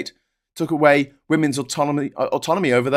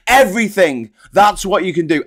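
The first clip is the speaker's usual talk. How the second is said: higher and louder, hitting back hard; angry retort; 5 semitones higher, 5 dB louder than usual. Real, not acted. Scolding lazy Democrats, loud and angry. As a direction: snap back the answer with force.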